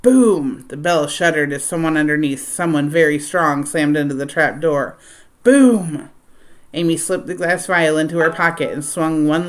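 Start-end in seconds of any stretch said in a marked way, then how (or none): 0.0-0.6 booming slam. A narrator's reading. Pounding on trap door. 5.4-6.1 louder, higher Boom!, harder hit; bigger booming slam. A narrator's reading. Someone strong slams the trap door, louder boom.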